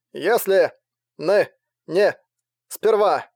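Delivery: radio voice breaking up; crackly cordless phone. A narrator's reading. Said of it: "добавил голос одобрительно".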